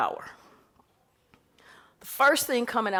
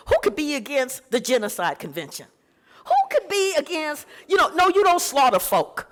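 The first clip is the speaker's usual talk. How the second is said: higher, louder disbelief; incredulous; 6 semitones higher, 3 dB louder than usual. Real, not acted. Lecturer asks the audience who could object, higher and louder in disbelief. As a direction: voice climbs in outraged disbelief.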